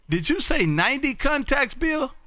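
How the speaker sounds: high, incredulous question; astonished double-check. Real, not acted. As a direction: pitch up on "ninety contacts" in disbelief. Asks again, astonished at count.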